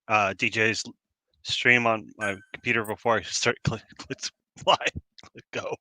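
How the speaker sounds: ordinary talk, nothing marked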